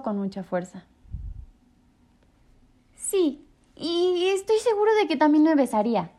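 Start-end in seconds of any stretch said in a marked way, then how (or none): 2.9-6.1 high small-bird voice, hopeful; a tiny bird telling what mama would do; little bird voice. A narrator's reading. Choco answers Mrs Bear.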